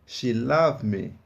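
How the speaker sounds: She loved me said very slowly, the d in loved barely heard; slow model sentence. Shown as a demonstration. Teacher repeating the sentence slowly so learners can hear it. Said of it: "Preste bastante atenção na pronúncia".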